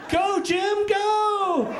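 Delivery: high-pitched mom yelling from the crowd; mother cheering. Retold or imitated. Mother cheers her son running with the torch, high-pitched shout. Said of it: "my mother's voice".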